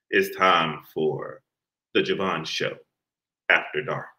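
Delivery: late-night announcer. Acted. Host opens late-night show.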